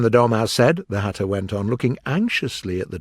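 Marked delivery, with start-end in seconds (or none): none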